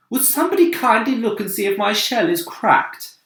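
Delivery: high polite ladybird voice. Acted. Ladybird worried about her shell, high polite voice. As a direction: ask it fussily and high. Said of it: "the ladybird said".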